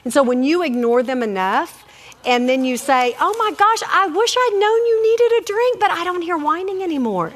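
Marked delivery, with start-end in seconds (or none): 3.2-7.3 high-pitched, sing-out mock sympathy; feigned regret. Shown as a demonstration. Mom to a whining child she has ignored, feigned regret. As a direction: play innocent, pitched way up.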